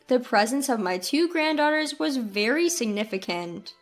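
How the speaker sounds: plain reading voice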